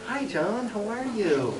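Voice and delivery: high, soft mock-sweet greeting; lightly voiced mother. Mother greets her son at her door, lightly imitated high and soft.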